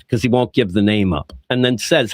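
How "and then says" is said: usual speaking voice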